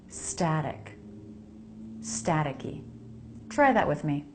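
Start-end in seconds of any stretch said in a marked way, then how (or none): 0.1-2.8 "Staticky" with its second T flapped; "Static" with a true T, then "Staticky" with a soft flapped second T. Shown as a demonstration. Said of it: "The second T is a flap".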